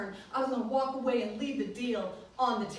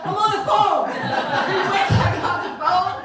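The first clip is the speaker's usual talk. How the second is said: high, loud, laughing through the words; loud laughing repeat; 9 semitones higher, 11 dB louder than usual. Retold or imitated. Preacher mimicking her caller, laughing loudly. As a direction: cackle out the quote, delighted.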